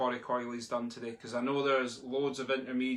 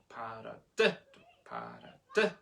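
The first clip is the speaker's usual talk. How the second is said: light para, sharp DI; accent on third syllable; 3 semitones lower than usual. Shown as a demonstration. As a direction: DI of paradiddle stands out. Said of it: "accent the third tap".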